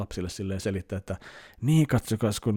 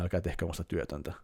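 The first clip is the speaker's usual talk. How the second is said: soft, quick broken plea, much softer; quiet pleading; 4 semitones lower, 8 dB quieter, faster than usual. Retold or imitated. Translating Brent's plea in a soft, broken voice. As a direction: mutter the plea under the breath. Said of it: "hyvin hiljaisella äänellä murtuu ja sanoo".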